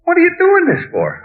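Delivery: very high-pitched exasperated question; incredulous challenge. Retold or imitated. Questioning the frantic waiter, high incredulous challenge. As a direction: pitch it way up in disbelief.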